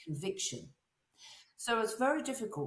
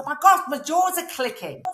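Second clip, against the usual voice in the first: louder and higher, exaggerated; mock loud complaint; 7 semitones higher, 10 dB louder than usual. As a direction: ham up aching jaws. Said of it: "they all go".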